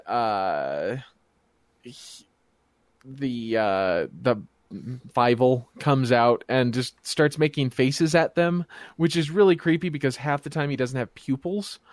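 ordinary talk

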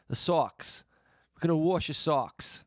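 loud, high Boston caricature; broad mock Boston accent; exaggerated Boston accent. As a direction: ham up the Boston vowels. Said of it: "such an oversimplification of it".

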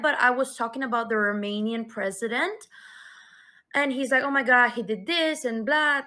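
ordinary delivery

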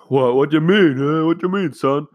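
high, loud mock bafflement; mock confused parents. Parents feigning confusion at son's eyebrow cut, mock baffled. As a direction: play the clueless parent, hammed up. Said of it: "we're like".

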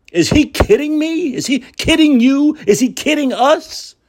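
high, indignant repeated question; outraged disbelief. Real, not acted. Host fuming to viewers about Newsom, in high outraged disbelief. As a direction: fume at the absurdity, pitch shooting up.

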